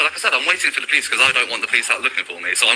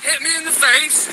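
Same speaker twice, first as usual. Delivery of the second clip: high, shaky report after an attack; slow, stunned announcement; shaken and agitated; 10 semitones higher, slower than usual. Real, not acted. Filmer reports assault to viewers.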